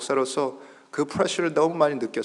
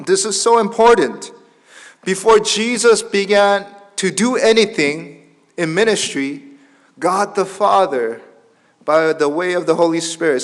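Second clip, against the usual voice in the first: loud, raised, slow preacher delivery; emphatic preaching; 5 semitones higher, 6 dB louder, much slower than usual. Real, not acted. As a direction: drive it home to the congregation. (힘주어 강조하는 설교)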